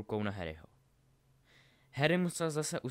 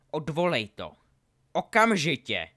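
loud, angry order rising in force; angry demand; 4 semitones higher, 10 dB louder than usual. A narrator's reading. Draco demands Harry take it back, angry. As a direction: demand it angrily, louder on the last word. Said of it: "řekl Draco naštvaně".

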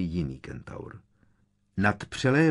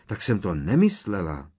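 high, loud anxious pleading; raised, panicky explaining; frightened protest; 6 semitones higher, 6 dB louder than usual. A narrator's reading. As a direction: protest urgently in alarm. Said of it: "vypískla vyděšeně Hermiona".

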